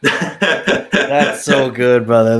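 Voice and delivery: slow, with a laugh in the voice; laughing, moved. Real, not acted. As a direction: savour it through a laugh.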